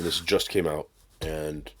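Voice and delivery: ordinary talk, nothing marked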